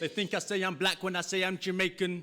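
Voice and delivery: high, projected rhythmic recital; spoken-word performance. Acted. Poet leading a crowd's call and response, high declaiming. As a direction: declaim it in punchy lines, leaving room for responses.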